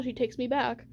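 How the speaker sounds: ordinary delivery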